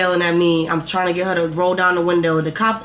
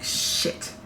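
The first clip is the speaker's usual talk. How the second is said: hushed, breathless whisper of shit; startled whispered curse as the police roll by; 9 dB quieter than usual. Retold or imitated. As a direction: whisper it under your breath so no one hears. Spotting police at night.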